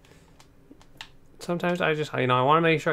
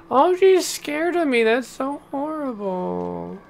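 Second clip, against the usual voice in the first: high pitying coo; 10 semitones higher, 4 dB louder, slower than usual. Real, not acted. Pitying a creature.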